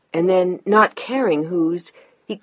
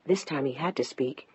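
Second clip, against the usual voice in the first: usual speaking voice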